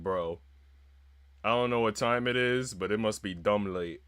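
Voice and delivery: ordinary talk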